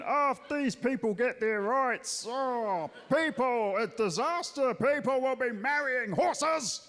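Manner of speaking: lightly voiced alarmist bloke, drawn out at the end; a touch of mock outrage; mock bloke Steve. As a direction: play a hint of a panicky pundit. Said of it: "Steve's always got the same opinion".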